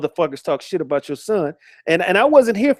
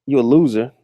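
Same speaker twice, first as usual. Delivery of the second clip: usual speaking voice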